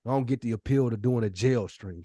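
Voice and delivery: ordinary delivery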